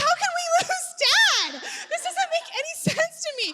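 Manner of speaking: high, loud retold disbelief; acting out baffled alarm with a laugh in it; incredulous alarm. Mother to kids on the raft about lost Dad, incredulous. Retold panic at missing husband.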